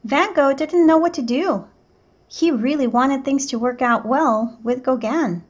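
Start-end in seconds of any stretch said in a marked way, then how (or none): none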